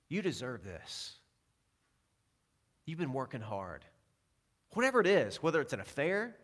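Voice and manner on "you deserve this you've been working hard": tempter's murmur. Retold or imitated. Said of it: "First, he says".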